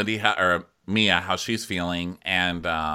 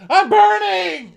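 high, drawn-out comic scream; mock agonized shout; 9 dB louder, slower than usual. [name] upstairs during smudging, melodramatic mock shriek. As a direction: wail it at full volume, hammed up.

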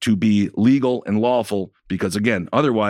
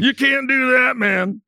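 very high-pitched put-on objection; comic high-voiced disbelief; mock protest; 12 semitones higher, slower than usual. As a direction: plead in a silly high voice, hammed up. Mock protest in the same joke.

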